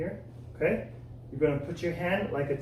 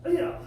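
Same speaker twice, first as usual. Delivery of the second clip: kiai shout; 13 semitones higher than usual. Shown as a demonstration. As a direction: yell it crisp and high. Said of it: "Number three is like this".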